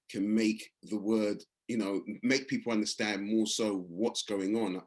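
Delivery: ordinary talk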